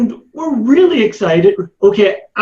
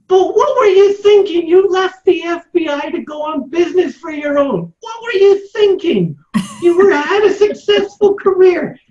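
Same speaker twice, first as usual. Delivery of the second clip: high-pitched, playful incredulity; mock scolding pitched way up; mock disbelief; 8 semitones higher than usual. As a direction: tease with exaggerated, disbelieving questions. Friend playfully challenges career choice.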